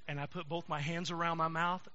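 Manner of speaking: ordinary talk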